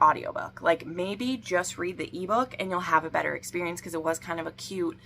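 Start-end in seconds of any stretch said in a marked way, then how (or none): none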